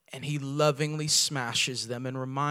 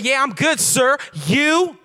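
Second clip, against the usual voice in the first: high, loud sassy reply; mock sassy wife, much higher and louder; 12 semitones higher, 13 dB louder, slower than usual. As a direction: toss it back with attitude.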